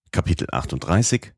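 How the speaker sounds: ordinary delivery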